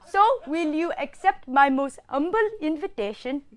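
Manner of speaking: lilting, courteous mock Indian accent; put-on Indian accent. Student making humble invitation, mock Indian accent. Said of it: "I will do the next accent".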